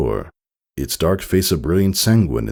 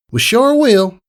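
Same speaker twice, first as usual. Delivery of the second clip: high filly voice; 7 dB louder than usual. A narrator's reading. Friends reply warmly.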